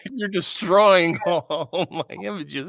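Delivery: high, slow words through real laughter; laughing protest. Real, not acted. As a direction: protest while cracking up. Laughing protest at co-host's joke.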